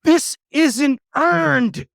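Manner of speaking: high, slow, furious yell; outraged shout. Acted. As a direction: bellow it like a ranting villain. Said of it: "your audio is going, you're going crazy".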